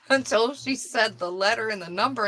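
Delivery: ordinary talk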